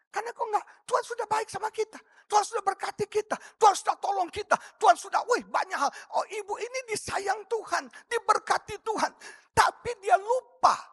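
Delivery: high-pitched sermon crescendo; impassioned preaching. Real, not acted. Preacher recalling how God blessed this woman, impassioned preaching.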